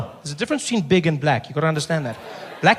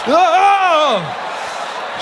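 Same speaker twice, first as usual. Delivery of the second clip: high, excited yelps; a woman's high yelp after a spank; woman's surprised cry; 10 semitones higher than usual. Acted. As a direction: squeal as if spanked.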